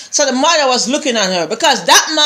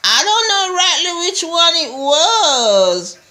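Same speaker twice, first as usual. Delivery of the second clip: high, drawn-out imitated answer; whining servant; 9 semitones higher, slower than usual. Servant answers the missus, high whiny mimicry. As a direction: stretch it out in a high whine.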